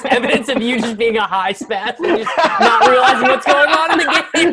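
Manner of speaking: laughing while teasing; high, loud, laughing through it. Real, not acted.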